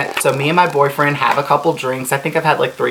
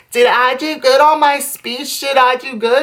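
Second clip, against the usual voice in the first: high, whiny mock-drunk pleading; pitched-up needy drunk voice; imitated drunk friend; 11 semitones higher than usual. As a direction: imitate a wasted friend begging for reassurance. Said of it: "like, wasted, like, shit-faced".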